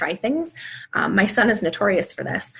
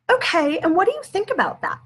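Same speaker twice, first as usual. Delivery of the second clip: higher prompt; 9 semitones higher than usual. Shown as a demonstration. Parent asking child about cousin's anger, higher bright question. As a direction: question lifted well above her usual pitch. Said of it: "Danielle, say".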